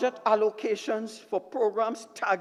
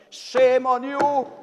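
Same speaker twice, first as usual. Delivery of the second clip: scolding; 4 semitones higher, 6 dB louder, slower than usual. Real, not acted. Scolding the government side.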